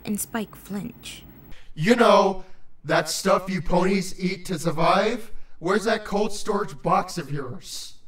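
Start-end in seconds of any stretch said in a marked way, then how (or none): 1.7-7.9 low, impatient creature voice; deep-voiced, hungry grump; stern, bloated creature. A narrator's reading. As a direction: demand it irritably in a deep voice. Irritated creature in story.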